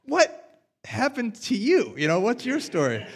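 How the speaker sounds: preacher voicing his grown-up question to the tax collector, amazed; incredulous concern. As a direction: ask it as if truly baffled and curious.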